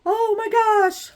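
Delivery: high, loud gasp of delight. Real, not acted. Seeing the new light pad light up, a high delighted gasp.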